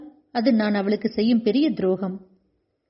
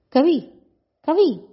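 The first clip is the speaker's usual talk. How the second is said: her name called higher and louder twice; calling out in panic; 5 semitones higher, 4 dB louder, much slower than usual. A narrator's reading. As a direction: shout her name to shake her out of it. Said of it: "என கத்திய வண்ணம்". (பதற்றத்துடன் பெயரை உரக்கக் கூப்பிடல்)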